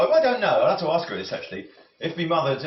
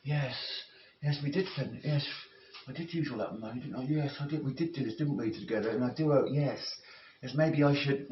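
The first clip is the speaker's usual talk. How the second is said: imagined inner voice; 6 dB quieter than usual. Retold or imitated. Mocking the spender's own excuses, quietly. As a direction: talk yourself round softly as someone else would. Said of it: "they don't think, Oh".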